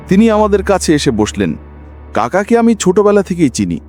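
ordinary delivery, nothing marked

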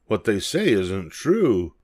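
usual speaking voice

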